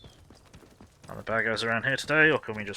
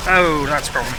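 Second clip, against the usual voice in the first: alarmed; 5 semitones higher, 13 dB louder than usual. Real, not acted. Dying to an enemy.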